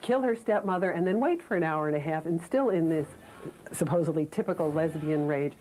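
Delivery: deliberately sarcastic, drawing out the opening to ridicule it; heavy sarcasm. Real, not acted. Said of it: "You hear the sarcasm in my voice".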